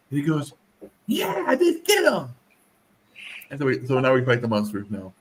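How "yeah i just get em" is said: put-on high, slow toddler voice; little kid. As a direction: imitate a proud two-year-old. Two-year-old quoted.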